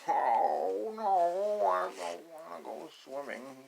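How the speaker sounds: loud high quack and wail of no, then slow; loud squawking duckling refusal; protesting duckling. Acted. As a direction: howl the no like a panicked duckling.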